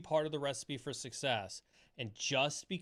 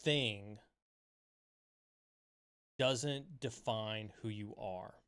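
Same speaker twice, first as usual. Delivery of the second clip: gentle reassurance; 4 semitones lower, 3 dB quieter, slower than usual. Retold or imitated. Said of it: "She said".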